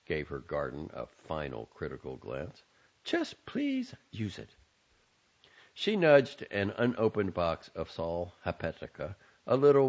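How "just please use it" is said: high, fretting mother voice; fussy mother voice. Acted. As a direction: nag like a worried mother. Mother pleads.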